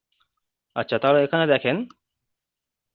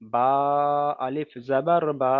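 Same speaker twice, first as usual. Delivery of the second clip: আলিফ জাবার বা recited slowly, বা held long; slow recitation drill; slower than usual. Shown as a demonstration. Teacher reciting the Qaida lesson slowly. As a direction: chant each syllable slowly. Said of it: "কেমন করে পড়বো".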